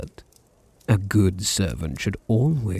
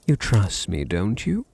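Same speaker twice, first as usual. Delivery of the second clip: usual speaking voice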